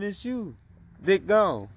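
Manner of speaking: ordinary talk, nothing marked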